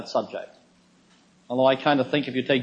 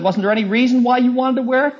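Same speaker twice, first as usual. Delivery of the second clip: high, louder retold question; insistent questioning; 9 semitones higher, 5 dB louder than usual. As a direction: press for a reason, imitating rising exasperation. Retold escalating exchange, questioner pressing.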